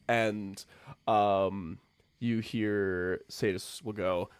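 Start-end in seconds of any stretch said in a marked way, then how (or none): none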